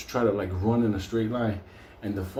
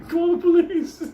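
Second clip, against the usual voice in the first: high loud mock shout; people screaming; 6 dB louder than usual. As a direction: yell it like panicked riders. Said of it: "People are screaming".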